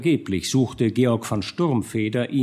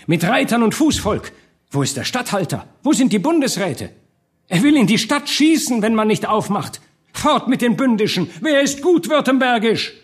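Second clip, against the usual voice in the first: high, urgent rallying cries; calling out; 10 semitones higher, 4 dB louder than usual. A narrator's reading. Newly arrived burghers rouse the town, calling out. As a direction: shout news and demands to a crowd. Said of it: "riefen sie".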